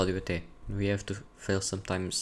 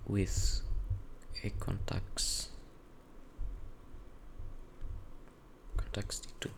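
whispering; 10 dB quieter, much slower than usual. Real, not acted. Murmuring while typing a search.

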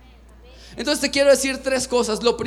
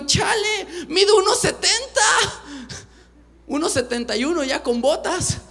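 mock complaint; 8 semitones higher than usual. Complains to mirror about height.

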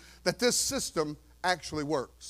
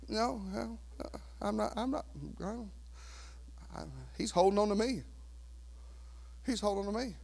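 much softer, serene reply; calm believer; 12 dB quieter, faster than usual. Pretend. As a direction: rest in God's hold. Believer answers calmly.

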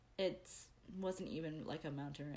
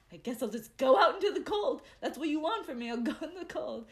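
mock exasperation; 8 semitones higher, 7 dB louder, faster than usual. Grumbling at fate after failed plans.

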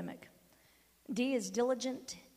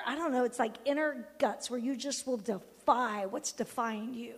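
usual speaking voice

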